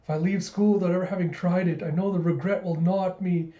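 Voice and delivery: milk the regret, building to the end; melodramatic yearning. Retold or imitated. George angles for a deluxe lunch, melodramatic. Retold boy milks his sympathy act.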